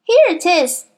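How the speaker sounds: put-on a wobbling boy agreeing eagerly; Nigel. A narrator's reading. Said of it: "Nigel said".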